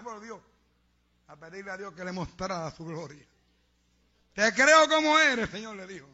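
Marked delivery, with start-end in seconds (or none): 4.3-5.8 loud, high, drawn-out mock cry; shouted surrender. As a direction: blurt belief to God, hammed up. Frightened man yields to God. (grito imitado de rendición ante Dios)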